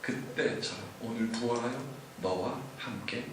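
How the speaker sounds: soft voice of the Lord. Acted. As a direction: speak as Jesus, gently and softly. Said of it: "여러분들에게 지금 말씀하십니다".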